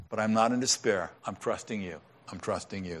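fading to a soft, low murmur of trust; hushed, surrendered whisper-like prayer; quiet prayer. Pretend. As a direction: pray it under your breath. Voicing a believer's quiet prayer.

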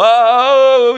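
high, loud mock wail of a hired mourner; mourner's wail. Preacher imitates hired mourners at a funeral, mock wail. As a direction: cry out like a paid mourner, hammed-up. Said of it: "And they would go".

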